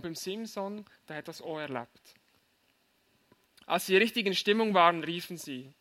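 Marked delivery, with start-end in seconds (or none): none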